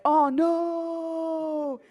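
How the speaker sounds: long, high drawn-out "oh no"; mock dismay. Onlookers watching Kathy embarrass herself, mock dismay. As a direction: stretch it out in exaggerated dismay.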